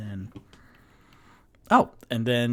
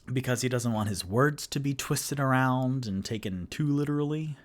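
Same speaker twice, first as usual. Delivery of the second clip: ordinary talk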